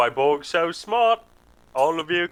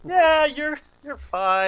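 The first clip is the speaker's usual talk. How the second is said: mock reassurance; 14 semitones higher, 4 dB louder, slower than usual. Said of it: "That's the confidence the surgeon wants".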